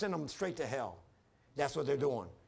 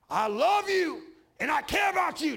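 yelled much higher and louder; harsh tone for kind words; 10 semitones higher, 7 dB louder than usual. Shown as a demonstration. Preacher shouts love at his German Shepherd to show tone, angry. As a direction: bark loving words like a threat. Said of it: "It's all in the tone".

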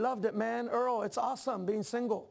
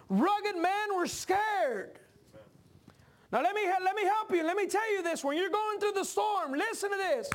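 full-force preaching; 7 semitones higher than usual. Acted. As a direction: preach it, climbing in pitch.

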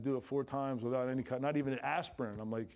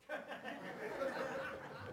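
airy wheezing laugh; breathy laugh; 9 semitones higher, 10 dB quieter than usual. Real, not acted. Preacher laughing at his own childbirth joke, airy wheeze. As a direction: laugh it out, caught off guard.

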